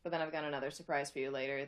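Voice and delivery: ordinary delivery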